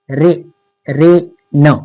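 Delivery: रे, रे, न split apart with pauses; syllable by syllable. Shown as a demonstration. Teacher splits the word for learners. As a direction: break रेन into syllables slowly. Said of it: "इथे ए असा उच्चार होतोय".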